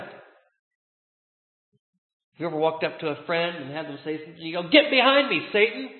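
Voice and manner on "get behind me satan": sudden raised voice, quoting a rebuke; imitated rebuke. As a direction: throw it out like a sharp reprimand to a friend. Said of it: "suddenly be very angry and very hurt".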